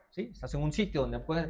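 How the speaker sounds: ordinary talk, nothing marked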